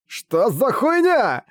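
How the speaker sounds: high, slow shocked yell; shocked shout. Acted. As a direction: yell it in horror. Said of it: "закричал Коди".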